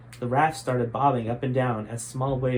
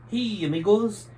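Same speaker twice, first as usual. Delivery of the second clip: higher, slow half-laugh; Jose greeting the others with a half-laugh; 9 semitones higher, 4 dB louder than usual. Acted. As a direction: greet them with a chuckle. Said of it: "half-laughed Jose".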